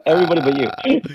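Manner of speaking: giggling through the words; laughing. Retold or imitated. Laughing punchline of coach quote.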